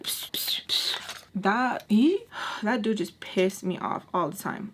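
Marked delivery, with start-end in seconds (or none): 0.0-1.0 hissed psh sounds, imitating a catcaller; catcall noise. Storyteller imitating the man's catcalls, catcalling psh noises. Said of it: "he was catcalling because he was like".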